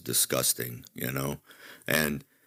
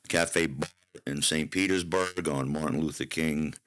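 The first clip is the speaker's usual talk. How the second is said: usual speaking voice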